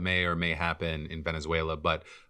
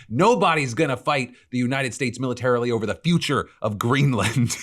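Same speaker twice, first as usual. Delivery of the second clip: mock shout; 6 semitones higher, 5 dB louder than usual. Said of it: "I need to scream it".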